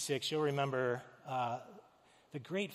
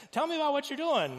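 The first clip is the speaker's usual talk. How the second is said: high, loud, eager-to-please question; put-on friendliness, pitched way up; trying to be nice; 11 semitones higher, 7 dB louder than usual. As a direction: ask in an over-friendly lilt. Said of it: "trying to be nice".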